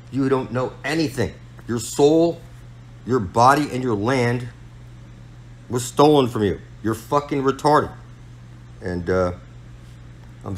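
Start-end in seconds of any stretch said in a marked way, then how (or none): 0.1-7.9 loud, higher, raging sermon at the listener; furious preaching. Real, not acted. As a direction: bellow it like a street preacher condemning sinners. Ranting preacher condemning listeners.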